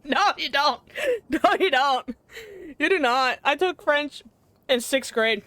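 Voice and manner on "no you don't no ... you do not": amused, laughing refusal; laughing protest. Real, not acted. Laughing off accent request.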